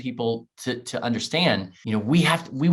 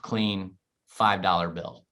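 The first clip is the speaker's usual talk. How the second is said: ordinary delivery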